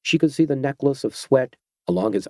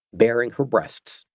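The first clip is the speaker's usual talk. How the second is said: usual speaking voice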